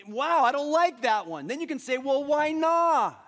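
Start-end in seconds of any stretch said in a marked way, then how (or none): 0.1-1.4 mock dismay. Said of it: "and you go". 2.0-3.2 loud, high, drawn-out "why not"; "why not" pushed up high and held; emphatic questioning. Acted. Acting out questioning one's own reaction.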